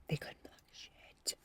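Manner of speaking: breathy stage whisper, slow. Acted. Tarot reader whispering to her viewer. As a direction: whisper it like a secret.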